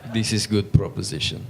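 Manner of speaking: terse mock Ukrainian accent. Ukrainian delegate approving the smoking offer, terse mock Ukrainian accent. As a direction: answer briefly in a put-on Ukrainian accent. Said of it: "guy from Ukraine's just like".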